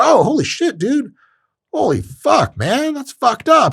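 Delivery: high, drawn-out mock shock; mock stunned. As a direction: act floored, pitched well up. Acting stunned after being shoved.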